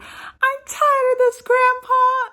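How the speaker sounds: put-on high, slow, weary girl voice; tired girl quote. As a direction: whine it like a worn-out kid. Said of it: "feel like the girl from Holes".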